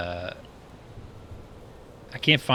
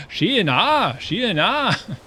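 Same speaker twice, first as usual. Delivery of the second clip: imitating Mattson, much higher and louder, in a thick Swedish accent; 12 semitones higher, 7 dB louder, much slower than usual. Streamer greeting his chat with a thick Swedish-accented hello. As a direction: sing out "Sheena" with a strong Swedish lilt, much higher and louder. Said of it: "He's like".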